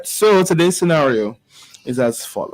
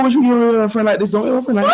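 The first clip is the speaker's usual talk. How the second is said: laughing, pitched way up; 9 semitones higher, 3 dB louder, much faster than usual. Real, not acted. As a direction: giggle through the question. Laughing at the scenario.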